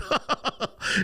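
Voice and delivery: high, breathy put-on cackling; imitated courtroom laughter. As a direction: laugh like a whole courtroom cracking up. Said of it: "everybody laughs".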